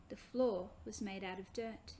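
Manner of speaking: usual speaking voice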